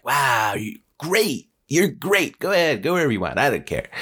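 high, excited dog voice; bouncing, high-pitched enthusiasm; delighted dog. Acted. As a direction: gush like a thrilled, easily won-over dog. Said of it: "The dog eats it up".

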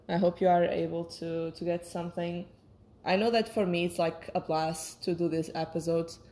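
ordinary delivery

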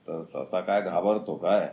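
plain reading voice

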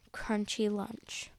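ordinary delivery

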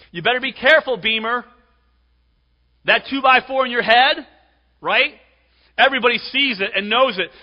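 high, forceful pulpit delivery; full-force preaching. Real, not acted. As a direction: drive it home to the congregation loudly.

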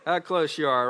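ordinary delivery

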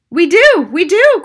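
very loud, high, panicky; alarmed cry. A narrator's reading. Father admitting they keep a cat, loud high panic. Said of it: "cried Mister Jenkins".

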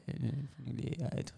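soft creaky fry, imitating American women; mock American vocal fry. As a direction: drop into a lazy creaky fry. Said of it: "les Américaines en général quand elles parlent".

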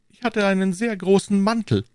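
high-pitched character voice; Aramis. A narrator's reading. Narrator voices Aramis in dialogue. (hohe Figurenstimme)

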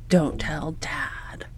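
soft whispered secret. Retold or imitated. Child asks mom to keep it from dad, whispered.

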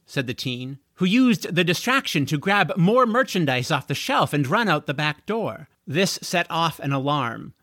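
usual speaking voice

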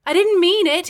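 high, loud plea; pleading, pitched high. A narrator's reading. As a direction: plead urgently to be believed. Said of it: "he said pleadingly".

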